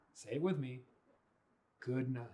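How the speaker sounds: usual speaking voice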